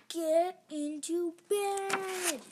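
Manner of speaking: high, loud, drawn-out roar; thundered. A narrator's reading. Monster looming over child, thundering. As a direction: bellow it slowly as a huge monster. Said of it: "Gabe thundered".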